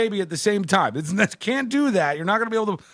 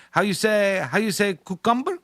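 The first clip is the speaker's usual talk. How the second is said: mock a faltering put-on Spanish accent; fake Spanish accent; 2 semitones higher than usual. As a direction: imitate someone groping for a word in a Spanish accent. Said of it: "feels the need to fake this accent".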